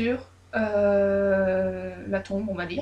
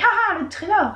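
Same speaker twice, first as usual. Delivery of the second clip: greedy delight; 9 semitones higher than usual. Retold or imitated. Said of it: "elle fait".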